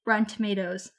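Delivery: usual speaking voice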